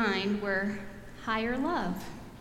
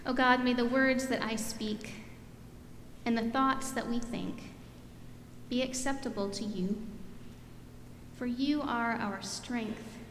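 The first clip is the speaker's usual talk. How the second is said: prayer; 4 dB quieter than usual. Real, not acted. Opening prayer.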